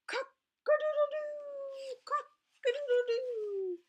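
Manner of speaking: very high, slow, loud crowing; rooster crow. Acted.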